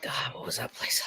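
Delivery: whispered to herself; breathy whisper to self. Real, not acted. Trying to remember, whispered.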